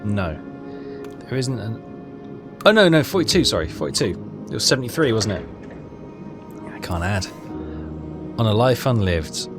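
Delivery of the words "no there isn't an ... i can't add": usual speaking voice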